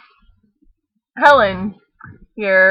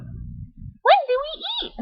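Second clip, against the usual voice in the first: talking dog voice; slower than usual. Acted. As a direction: bark like an eager little dog. Dog Martha asks.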